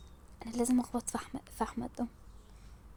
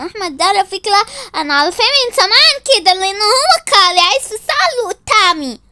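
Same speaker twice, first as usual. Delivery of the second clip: loud, higher-pitched boasting; raised-voice bickering; heated argument; 5 semitones higher, 5 dB louder than usual. Acted. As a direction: argue it loudly over a rival. Jealous quarrel over Ahmad.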